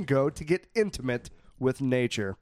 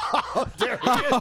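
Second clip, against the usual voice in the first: high, slow mock British voice; a jokey British lilt; mock British accent; 8 semitones higher than usual. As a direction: put on a hammed-up British accent. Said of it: "Oh, so British".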